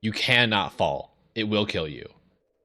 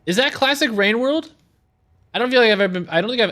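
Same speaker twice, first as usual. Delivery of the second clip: high surprised outcry; 13 semitones higher, 5 dB louder, faster than usual. Real, not acted. Surprised outburst.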